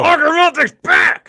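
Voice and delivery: high, loud hype call; excited announcement. Real, not acted. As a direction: announce his return like a hype man. Excited shout of listener name.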